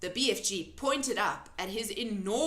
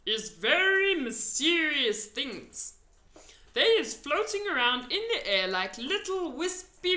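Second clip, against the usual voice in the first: mysterious giant; 5 semitones higher, 5 dB louder than usual. A narrator's reading. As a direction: draw her into a secret. BFG mysterious.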